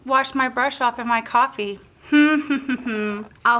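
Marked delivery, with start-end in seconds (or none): 2.1-3.4 embarrassed laugh. Real, not acted. Washed brush in her coffee.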